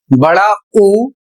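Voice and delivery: बड़ा ऊ stretched slow as the long ऊ to avoid; slow, drawn out बड़ा ऊ showing the mistake; drawn-out wrong long u. Shown as a demonstration. Teacher warns students off long ऊ, drawing it out. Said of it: "गलती आपको नहीं करना है".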